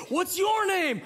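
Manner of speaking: high, peppy host question; mock perky emcee; microphone host. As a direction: imitate an upbeat crowd host. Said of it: "a lady comes out with a microphone".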